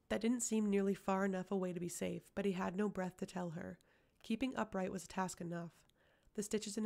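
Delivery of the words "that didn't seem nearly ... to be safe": plain reading voice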